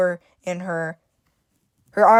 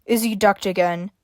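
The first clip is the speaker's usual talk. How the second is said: usual speaking voice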